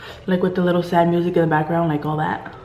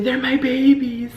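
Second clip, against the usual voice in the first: imitating melodrama; 6 semitones higher, slower than usual. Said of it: "she even changed her voice".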